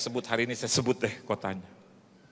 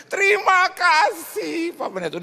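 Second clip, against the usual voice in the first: high, slow thank you; drawn-out old lady thanks; old woman caller; 6 dB louder, slower than usual. Retold or imitated. Widow thanking the pastor, high and slow.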